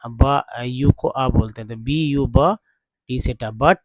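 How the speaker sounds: ordinary talk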